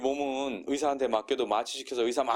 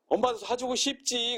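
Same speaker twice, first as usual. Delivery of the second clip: 높은 목소리로 하소연하듯; a high, pleading mother's lament; whiny mother; 10 semitones higher than usual. Retold or imitated. 상처 있는 엄마의 하소연 재현. (높게 하소연하는 엄마)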